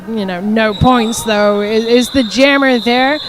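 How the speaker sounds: loud, projected sports announcing; strong, steady roller derby commentary; play-by-play commentary. Real, not acted. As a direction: call the action live to the crowd.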